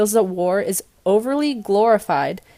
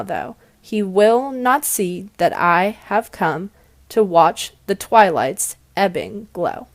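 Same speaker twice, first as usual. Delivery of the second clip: slow reading, "Whose flag is this" with every second syllable hit; stressed syllables marked; slower than usual. Shown as a demonstration. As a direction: beat out the iambs slowly, da-DUM da-DUM. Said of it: "I've bolded and underlined the stress syllables".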